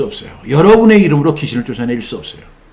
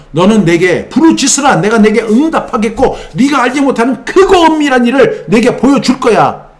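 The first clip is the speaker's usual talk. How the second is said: raised, higher promising voice; God promising answer to the one who prays; 6 semitones higher, 3 dB louder than usual. Retold or imitated. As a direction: speak as God reassuring his child. Voicing God's promise.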